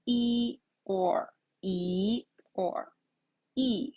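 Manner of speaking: "E or E or E" as level, rising, falling; change the tone on each "E"; first, second, then fourth-tone "E"; three tones contrasted. Shown as a demonstration. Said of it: "the first, second, and the fourth tone".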